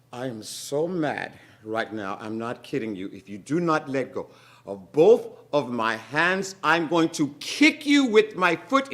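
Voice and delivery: building to loud; angry threat. Acted. Moesha warning the gum baby, angrily. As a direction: threaten to kick.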